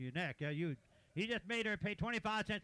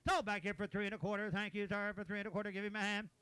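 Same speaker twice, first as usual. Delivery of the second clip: auctioneer; 3 semitones higher than usual. Real, not acted. Auctioneer calling bids.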